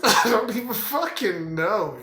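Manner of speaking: loud, high laughing disbelief. Real, not acted. Man baffled by his own youthful purpose, laughing. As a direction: burst out through a laugh, pitch jumping up.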